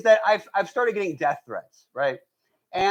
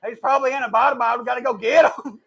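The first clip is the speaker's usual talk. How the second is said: put-on presidential rallying cry, raised and loud; George W. Bush; 6 semitones higher, 6 dB louder than usual. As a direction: bellow it as an urgent call to go. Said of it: "George W. Bush was like".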